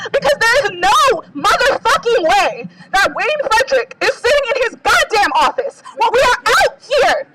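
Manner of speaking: sobbing rage at the administration; crying outrage. Real, not acted. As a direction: let the anger and tears break out together. Tearful protest speech erupting.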